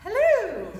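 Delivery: high, loud, sing-song hello; sing-songy head voice. Shown as a demonstration. Coach greeting her husband in a high sing-song morning voice. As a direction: lilt it up high like greeting a child. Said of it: "I might be like".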